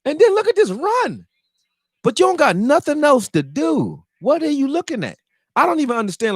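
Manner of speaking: high, loud exasperated rant; frustrated ranting. Real, not acted. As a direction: yell at the TV over a blown coverage. Ranting at the defense on screen.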